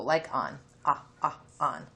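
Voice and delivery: "ah, ah, on" with an open short o like "ah"; short o sound. Shown as a demonstration. Teacher modelling short o for kids, open and clear. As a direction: drop the jaw for "ah" in "on". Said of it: "we're circling short O".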